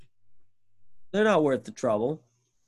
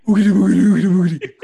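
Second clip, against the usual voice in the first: loud, high burst of mock-crazy gibberish; crazy coworker; 7 semitones higher, 13 dB louder, much faster than usual. Maintenance guy babbling at his coworker, hammed-up crazy outburst. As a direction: blurt it out loudly in a hammed-up crazy voice. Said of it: "He goes".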